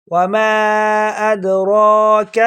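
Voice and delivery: وما ادراک very slow, heavy را; tajweed; correct recitation. Shown as a demonstration. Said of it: "ایسے پڑھیں گے".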